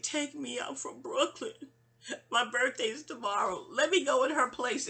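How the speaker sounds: mock urgent begging in a friend's voice; pleading friend. Friend begs to be taken out from Brooklyn, pleading.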